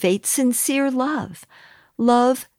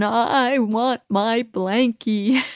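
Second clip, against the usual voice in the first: put-on slow, whimpering little-boy plea; crying toddler; much slower than usual. Mother retelling her son crying again with thumb out, slow childlike whine. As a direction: draw it out like a crying three-year-old. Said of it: "started crying again".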